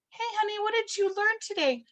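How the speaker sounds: put-on high, sing-song parent asking a child. Parent asking honey about the day, high sweet voice.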